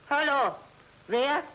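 calling high and loud into the receiver; loud phone call. Acted. Old man on the phone, calling loudly to the caller. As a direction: shout to be heard on the line. Shouting into a new telephone.

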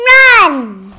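loud urgent shout; shouting run. Acted. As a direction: yell it to make everyone flee. Bears flee the witch.

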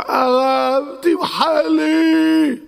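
high, very slow tearful begging; tearful plea. Acted. Host begs the rabbi to forgive him, tearful plea. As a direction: beg for forgiveness near tears.

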